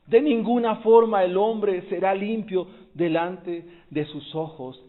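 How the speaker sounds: preaching at full force. Acted. Predica con toda fuerza.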